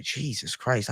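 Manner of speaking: ordinary talk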